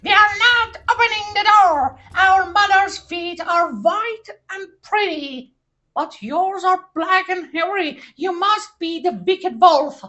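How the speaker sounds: shouting together. Acted. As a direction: yell it back defiantly. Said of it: "They all shouted together".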